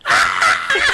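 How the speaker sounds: loud mock scream of a crushed kid; imitated scream. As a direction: scream like the kid under the snowball.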